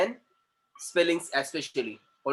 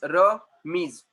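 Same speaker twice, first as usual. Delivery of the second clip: "Ramiz" slowly, Ra, pause, miz; two separate beats on "Ramiz"; split into syllables; 3 dB louder than usual. Shown as a demonstration. Teacher counting two syllables, "Ramiz" split in two. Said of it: "two syllables".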